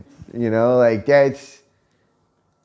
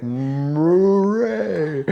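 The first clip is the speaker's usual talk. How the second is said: long drawn-out moo, rising high; mooing cow; 5 semitones higher than usual. Acted. Joker imitating the cows, drawn-out moo. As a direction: stretch it into a comic moo. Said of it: "They're like".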